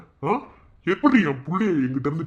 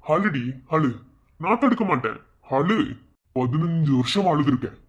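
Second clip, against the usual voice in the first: usual speaking voice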